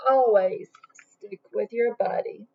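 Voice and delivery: ordinary delivery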